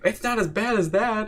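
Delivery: high, put-on downplaying voice; imitating a friend's high, casual reply; friend shrugging it off. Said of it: "And he's like".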